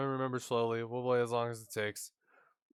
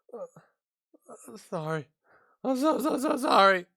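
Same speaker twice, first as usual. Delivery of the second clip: much higher and louder, a laughing sob in it; flustered teary apology; 9 semitones higher, 7 dB louder than usual. Acted. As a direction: squeak out a desperate sorry. Mikan apologizing, laugh-cry heard.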